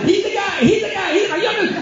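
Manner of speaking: excited. Retold or imitated. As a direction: gush it high like a thrilled fan. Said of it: "He gets all excited".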